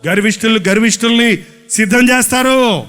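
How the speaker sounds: ordinary talk, nothing marked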